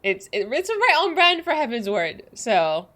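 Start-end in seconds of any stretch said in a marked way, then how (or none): none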